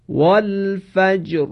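والفجر with the ر made heavy and full, drawn out slowly; heavy Quranic r. Shown as a demonstration. Quran teacher models the word for the class, slow and heavy. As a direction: stretch والفجر with a thick, heavy ر. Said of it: "باید دقت کنید که را تفخیم بشه".

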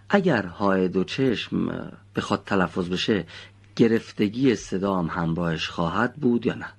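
usual speaking voice

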